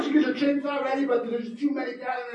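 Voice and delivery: ordinary delivery, nothing marked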